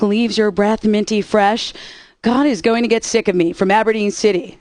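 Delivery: ordinary talk, nothing marked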